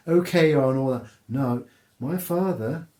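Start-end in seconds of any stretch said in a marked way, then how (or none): 0.1-1.1 posh aristocrat. Retold or imitated. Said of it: "the aristocratic".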